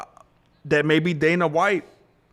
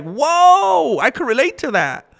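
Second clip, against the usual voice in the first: drawn-out, much higher, louder whoa; amazed whoa; 12 semitones higher, 5 dB louder, slower than usual. Real, not acted. Streamer reacting to the psalm with a slow, high whoa. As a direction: let the whoa hang in awe.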